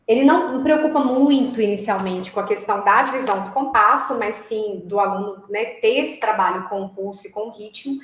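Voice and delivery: normal talking voice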